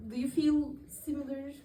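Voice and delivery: normal talking voice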